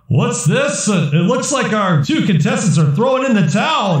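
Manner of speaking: high-pitched announcer voice; arena announcer. Acted. Announcer tells the crowd contestants are quitting, high showman's voice. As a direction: call it like a puzzled fight commentator, pitched well up. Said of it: "And the announcer says".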